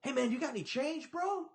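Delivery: high, loud pushy ask; a stranger hustling for change; imitating the panhandler. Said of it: "he's like".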